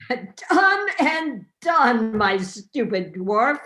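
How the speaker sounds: loud, slow laughing bellow. Acted. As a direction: bellow it like a merry king. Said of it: "he bellowed".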